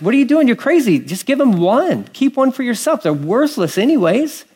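high, incredulous scolding; scolding onlookers. Retold or imitated. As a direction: play the scandalised bystander, voice up high.

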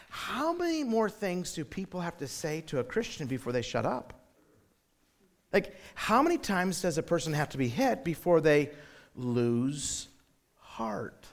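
soft, subdued inner question; weary, quiet wondering to yourself; discouraged musing. Retold or imitated. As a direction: sink to a low, halting finish. Weary inner thought after story.

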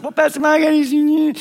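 high, evasive wife dodging the pastor; sheepish wife voice. Retold or imitated. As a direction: pitch it up and play innocent.

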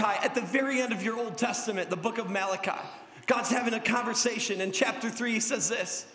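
ordinary talk, nothing marked

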